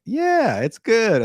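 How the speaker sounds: high, drawn-out mock "yeah"; parents' stock answer. Parent answering how life with baby is, high put-on positivity. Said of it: "when you talk to parents, it's always like".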